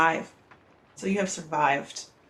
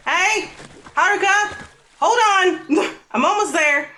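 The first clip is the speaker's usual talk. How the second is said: high, loud call across the water; 13 semitones higher, 7 dB louder than usual. Acted. Belle calling to Haruka from the water, high and loud. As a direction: shout to reach her friend, breathless.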